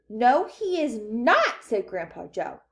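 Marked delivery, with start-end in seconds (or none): 0.1-1.6 loud, slow, emphatic denial; firm contradiction. A narrator's reading. Grandpa Joe defending Wonka to parents, emphatic. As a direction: weigh each word heavily.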